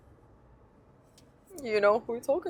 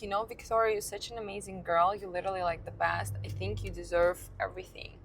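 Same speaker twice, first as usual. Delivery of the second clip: kind friend; 2 semitones lower, 7 dB quieter, faster than usual. Retold or imitated. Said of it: "the guy goes".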